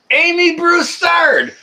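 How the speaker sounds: high, slow, drawn-out call. Real, not acted. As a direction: sing out the name loudly, stretched and high. Calling out a chat member's name.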